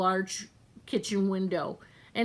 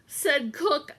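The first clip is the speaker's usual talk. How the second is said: usual speaking voice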